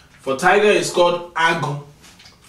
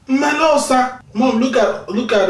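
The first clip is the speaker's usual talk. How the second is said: excited pointing out; 8 semitones higher, 4 dB louder than usual. Pretend. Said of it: "If you see a squirrel, you want to call it".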